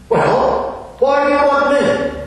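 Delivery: high, startled disbelief; can't believe he'd ask her; retold Gladys, taken aback; incredulous question. Gladys baffled by the Mandarin.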